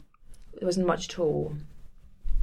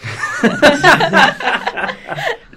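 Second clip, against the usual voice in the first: high burst of real laughter; loud laugh; 13 semitones higher, 16 dB louder than usual. Real, not acted. Laughing at clean clothes.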